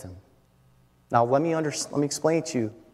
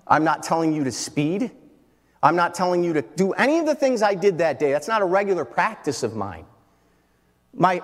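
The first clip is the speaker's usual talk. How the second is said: normal talking voice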